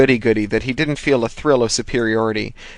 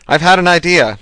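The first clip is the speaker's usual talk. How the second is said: loud, high and pleased with himself; proud announcement; 7 semitones higher, 8 dB louder than usual. A narrator's reading. Thomas tells Hershey his idea, gleefully. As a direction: Thomas crows his big news, beaming.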